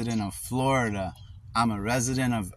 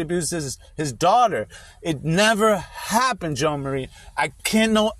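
real, higher-pitched urgent pleading; impassioned protest; 4 semitones higher than usual. Real, not acted. Father pleads with caseworker over his daughter, impassioned protest. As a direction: plead your innocence insistently, hammering key words.

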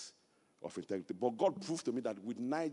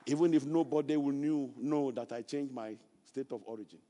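usual speaking voice

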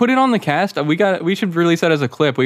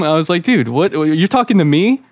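normal talking voice

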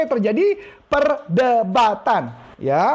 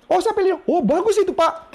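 much higher, bright eager praise to the customer; 9 semitones higher than usual. Shown as a demonstration. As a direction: gush with delight over his choice. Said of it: "Kita puji".

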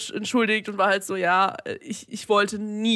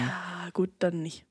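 sulky murmur; 4 semitones lower, 8 dB quieter, slower than usual. Real, not acted. Sulks after being overruled.

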